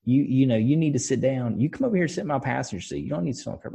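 put-on sweet old lady offering her seat; grandma style. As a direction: coo it like a kindly old grandma. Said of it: "she's like a grandma style".

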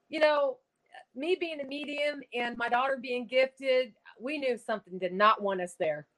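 ordinary talk, nothing marked

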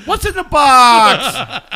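slow, loud dramatic quote; movie quote. Retold or imitated. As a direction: draw out the famous line. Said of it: "I'm reminded of the movie Seven".

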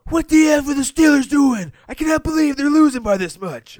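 imitating a bar fan's high, loud outrage; incredulous fan rant. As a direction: holler in disbelief at the TV. Acting out frustrated Steelers fan.

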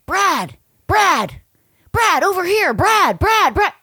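high, loud repeated calls of Brad; imitating paparazzi shouting. Paparazzi calling to Brad Pitt off the plane, high loud shouts. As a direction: mimic a crowd of photographers yelling his name. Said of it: "I start hearing".

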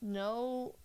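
ordinary talk, nothing marked

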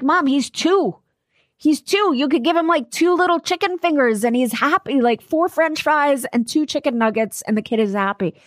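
high, incredulous protest to mom; exasperated. Retold or imitated. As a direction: plead with mom that a toddler needs little. Exasperated with mom over feast.

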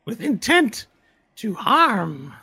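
high creepy voice talking to itself; paranoid, questioning dark creature; eerie creature voice. Acted. Creature talks to Toby and party.

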